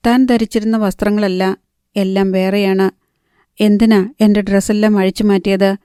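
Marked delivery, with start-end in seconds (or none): none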